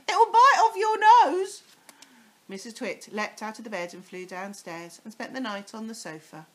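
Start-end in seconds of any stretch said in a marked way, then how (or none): 0.1-1.6 very high, loud menacing tease; scary gloat. Acted. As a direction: frighten her off the bed. Mr Twit scaring wife.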